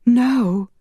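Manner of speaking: high, loud cry of horror; gasped cry. A narrator's reading. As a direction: cry out in shock. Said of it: "She gasped".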